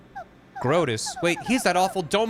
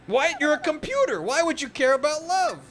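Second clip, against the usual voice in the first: much higher, incredulous; astonished princess; 13 semitones higher than usual. Acted. Peach amazed a computer cares about love, high disbelief. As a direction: squeal it in disbelief.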